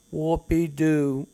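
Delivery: slow, drawn-out sarcastic cheer; mock the complaint with fake glee; sarcastic whoopee. Reading the wife's letter aloud, sarcastic slow whoopee.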